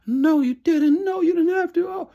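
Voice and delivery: high-pitched overjoyed imitation; overjoyed squeal. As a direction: squeal it like she can't believe the gift. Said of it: "she was like gasps".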